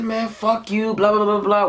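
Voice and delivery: high-pitched heated retort; retold angry comeback. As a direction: act out firing an insult back. Retelling his shouted comeback.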